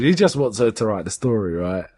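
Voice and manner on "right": ordinary talk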